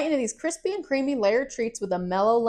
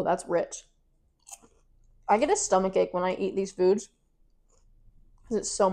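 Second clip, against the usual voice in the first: chewy muffled words between bites; talking while chewing. Real, not acted. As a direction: mouth partly full of popcorn. Seen 1: Closed mouth chewing, holds popcorn; eats another piece at 4.9.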